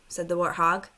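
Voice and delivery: ordinary delivery, nothing marked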